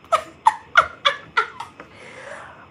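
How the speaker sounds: high breathy burst of laughter; giddy disbelieving laugh. Real, not acted. Meeting Gabriel on set, high giddy laugh. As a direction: laugh out in shock.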